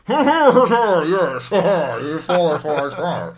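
high, loud, laughing and slow on qualify; a hammed-up, sly, drawn-out yes; smug Tom Hardy. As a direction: gloat like he's won. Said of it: "he's like".

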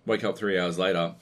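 normal talking voice

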